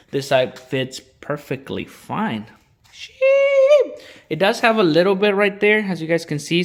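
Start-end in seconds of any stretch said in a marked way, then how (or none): 2.9-3.9 a sudden high-pitched squeal of a word; high squeaky exclamation. Real, not acted. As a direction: yelp it out high. Sudden exclamation while working.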